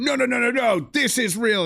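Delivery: high mocking retort; mock protest. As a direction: imitate a high-pitched rapid objection. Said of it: "and you've just gone".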